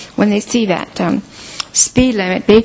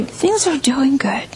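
whispered; 5 semitones higher than usual. Shown as a demonstration. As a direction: whisper it, things are doing good.

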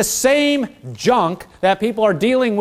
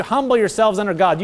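usual speaking voice

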